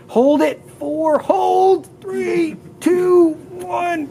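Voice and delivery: high, loud encouraging countdown; coaching count. Real, not acted. Physio coaching a patient to hold, high and loud. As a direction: push them through the hold, counting up high.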